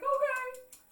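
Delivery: very high, loud squeaky wail; exaggerated tearful agreement; mock-tearful okay. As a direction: give in with a comic crying squeal. Mimics own tearful meek reply.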